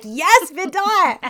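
drawn-out, high cheer; loud, high fan whoop. Real, not acted. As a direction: cheer on your favorite character, stretching the words. Cheering on a character she recaps.

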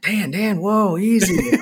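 mock alarmed friend trying to settle him; calming friend. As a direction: friend calming Dan on the trail, lightly voiced.

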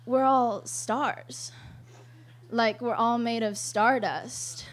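slow, soft and airy, drawn out; dreamy mock mystic. Girlfriend explaining stardust to her partner, slow dreamy mock mysticism. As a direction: float the words like a spaced-out stargazer.

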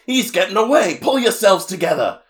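high, loud villain yelling at his minions; King Dedede. Retold or imitated. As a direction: bellow urgent orders in a cartoon king's voice. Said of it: "And D D D's like".

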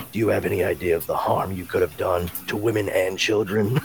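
low, soft stern scolding; a brooding vigilante lecturing; stern hero voice. Retold or imitated. Hero scolds the caught crook.

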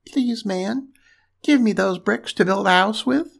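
high little pig voice; pleading little pig. A narrator's reading. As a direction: ask nicely in a high storybook piglet voice. Third pig begging for bricks.

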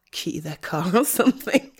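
laughs through the joke; giggling half-joke; laughing. Real, not acted. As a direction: laugh at your own wicked idea.

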